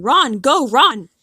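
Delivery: loud, high urgent shout. Retold or imitated. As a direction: yell it to chase them out the door.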